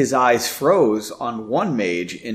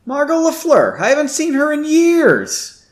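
pitched up, louder and delighted; suddenly louder, glad recognition; 11 semitones higher, 5 dB louder than usual. A narrator's reading. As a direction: call her name out happily. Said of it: "she said somewhat louder".

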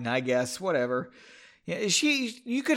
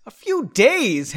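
slow, louder surprised question; exclaiming; 6 semitones higher, 7 dB louder, slower than usual. Acted. Henry hearing they leave for days, surprised exclaim. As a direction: stretch it out in disbelief. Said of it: "Henry exclaimed".

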